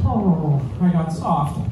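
usual speaking voice

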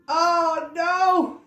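high, loud, groaning Mister Bear; Mister Bear, fed up. Acted. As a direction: wail it like a sleepless bear at the end of his rope. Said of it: "said Mister Bear".

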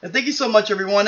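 usual speaking voice

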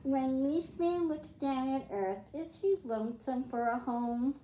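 put-on reading a picture book aloud to kids; storybook reading. A narrator's reading. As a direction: read it like a bedtime story for the class. Teacher reads picture book aloud.

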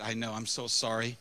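low, soft retold apology; acting out quiet remorse; contrite apology. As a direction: drop the voice low and apologize softly. Apology repeated after being rebuked.

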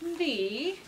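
ordinary delivery

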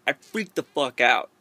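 usual speaking voice

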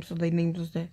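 ordinary delivery

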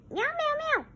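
high playful meow; cat meows. Pretend. Pete the cat toy.

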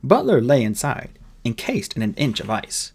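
ordinary delivery, nothing marked

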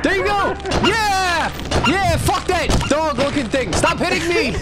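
frantic high yelling; panicked shouting at a teammate; being chased. Real, not acted. Chased by monster in game.

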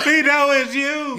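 laughing shout. Real, not acted. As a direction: yell it through laughter. Busting the fake voice.

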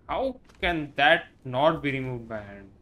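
loud, incredulous question; how can that not be, loud; loud disbelief. Real, not acted. Disbelief at the game.

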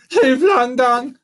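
high, loud monkey call; frantic cry. A narrator's reading. Monkey getting beside himself, frantic cry. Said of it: "Getting a bit beside himself".

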